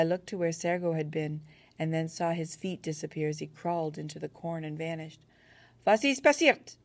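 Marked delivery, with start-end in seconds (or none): 5.9-6.7 high, loud alarmed yell; yelled question. A narrator's reading. German soldier yelling across the field, high and loud. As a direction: shout it out in alarm. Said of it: "a German had yelled".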